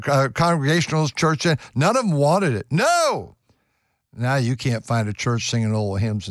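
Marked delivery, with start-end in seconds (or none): 2.7-3.3 emphatic no. Acted. Preacher exclaims against rock and roll.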